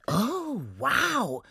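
high, drawn-out wonder; awed gnome. Acted. Gnome hearing of a prince, high and awed. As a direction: swoop high in admiration.

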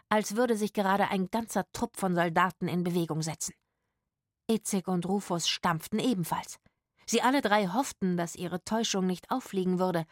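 usual speaking voice

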